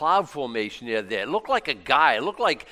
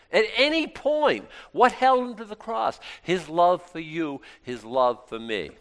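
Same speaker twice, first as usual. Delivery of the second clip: ordinary talk, nothing marked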